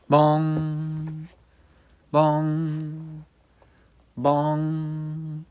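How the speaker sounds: high held bong like a clock bell; clock chime. Acted. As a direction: ring it out like a tolling clock. Clock strikes midnight.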